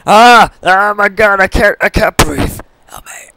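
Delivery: normal talking voice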